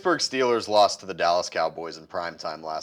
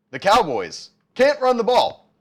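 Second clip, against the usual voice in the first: sarcastic; 11 semitones higher, 7 dB louder than usual. Real, not acted. Sarcastic setup before "indeed ran.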